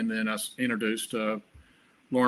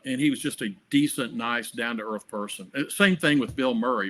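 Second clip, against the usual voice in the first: normal talking voice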